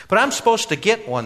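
put-on high, loud young man's protest. A narrator's reading. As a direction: object earnestly, pitched right up. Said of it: "the young man protested".

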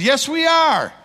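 retold call across a room; yelling back. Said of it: "And I yelled back".

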